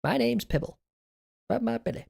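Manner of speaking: tiny, high, soft creature voice; cute Pibble voice. Acted. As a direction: squeak it softly like a little pet.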